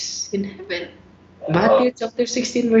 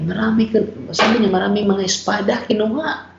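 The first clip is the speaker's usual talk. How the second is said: normal talking voice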